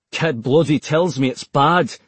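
put-on thick Scottish accent, joking through pain. Burned soldier teases the healers in a thick Scottish accent. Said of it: "in a thick Scottish accent".

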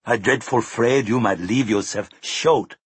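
Jamaican cabby, teasing; lightly voiced Jamaican driver; Jamaican accent. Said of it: "in a powerful Jamaican accent".

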